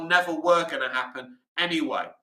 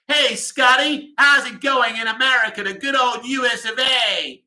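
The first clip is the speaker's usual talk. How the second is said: hammed-up American accent, stretched and pitched up; mock American accent; 7 semitones higher, 5 dB louder than usual. Host hails an American viewer in the good old U S of A, mock American accent.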